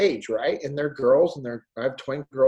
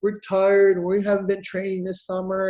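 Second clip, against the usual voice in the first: put-on higher whining kid voice; whiny kids; 5 semitones higher than usual. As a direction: complain like a tired child. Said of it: "they're like".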